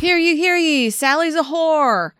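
high, slow proclaiming crier voice; town crier. Pretend. Mock town crier announcement.

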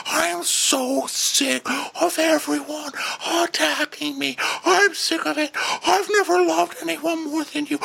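very high, strained mock stage whisper; Shep stage whisper. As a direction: whimper it out slowly, squeezed and hammed-up. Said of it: "like, stage whispering to Taylor".

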